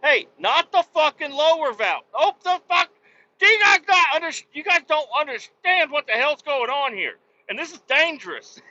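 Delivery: high, loud exasperated yelling; exasperated shout. Retold or imitated. Trucker yelling at workers who closed the wrong valve, exasperated high shout. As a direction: yell at them, alarmed and fed up.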